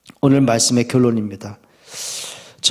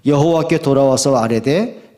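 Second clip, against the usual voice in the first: ordinary talk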